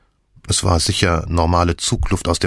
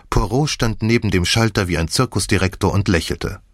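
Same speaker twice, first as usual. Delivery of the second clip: ordinary delivery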